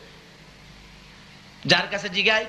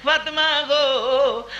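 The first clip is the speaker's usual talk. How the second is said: plaintive call; 4 semitones higher, much slower than usual. Retold or imitated. Weeping father calls to daughter.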